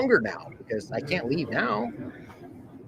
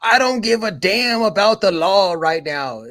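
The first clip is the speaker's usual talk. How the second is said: slow, louder mocking repeat; mocking quote; 4 semitones higher, 6 dB louder than usual. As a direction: parrot his words back with scorn. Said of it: "He literally just said that".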